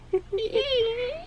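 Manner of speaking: soft, very high bat-like squeal; highest-pitched laugh. Shown as a demonstration. Trying again at the manager's laugh, soft high squeal. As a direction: squeak out a tiny mock shriek-laugh. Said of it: "Just the highest pitch".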